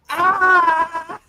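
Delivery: high, loud hammed-up scream; mock scream. Imitating Frodo falling at the end, hammed-up scream.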